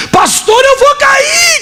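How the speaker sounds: high, hammed-up frightened wail; tearful panic. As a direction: imitate a crying woman pleading with pastor. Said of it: "Começou a chorar". (imitando grito choroso de medo)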